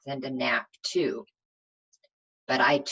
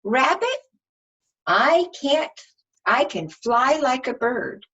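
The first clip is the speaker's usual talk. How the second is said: put-on child character voice; 10 semitones higher, 7 dB louder than usual. Child character addresses rabbit.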